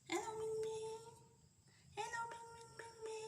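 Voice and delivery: sweet high-pitched hello to little pets. Real, not acted. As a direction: coo their names up high and soft. Cooing greeting to baby animals.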